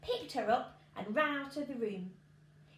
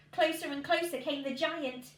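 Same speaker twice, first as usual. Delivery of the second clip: usual speaking voice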